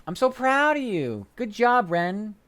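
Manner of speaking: high sing-song praise; mock proud praise. To chat, high sing-song proud praise. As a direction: gush over Ren like a proud parent.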